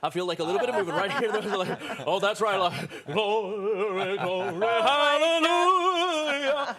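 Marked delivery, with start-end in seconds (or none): 2.6-6.8 slow, far-off Elvis croon rising to a loud, high "hallelujah"; hammed-up Elvis gospel build; [name]. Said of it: "[name]".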